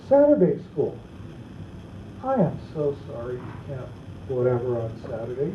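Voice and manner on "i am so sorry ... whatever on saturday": mock sympathy. Dad's fake sympathy to kid.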